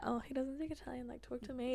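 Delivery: soft, casual, breezy tone; trying to be cool. Retold or imitated. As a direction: play it cool and offhand. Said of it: "trying to be cool".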